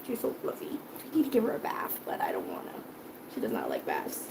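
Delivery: hushed whisper near the cat. Real, not acted. Owner whispering about giving her cat a bath. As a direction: whisper it so the cat won't hear.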